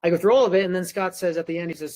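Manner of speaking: ordinary talk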